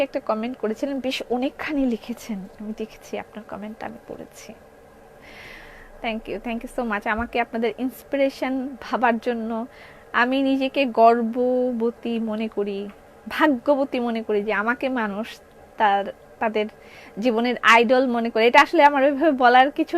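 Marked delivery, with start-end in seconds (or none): 0.0-4.5 soft, moved. Real, not acted. Touched by a viewer's comment. 6.0-18.5 softer, slow heartfelt thanks; sincere thanks. Real, not acted. Seller thanking viewers who call her their idol, soft and sincere.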